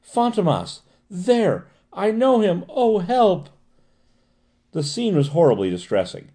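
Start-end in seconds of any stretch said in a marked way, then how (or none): 0.0-3.5 high-pitched acted panic; terrified cries. A narrator's reading. Cornered patient pointing out the man she fears, terrified. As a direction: cry for help, pitch held very high. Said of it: "she cried".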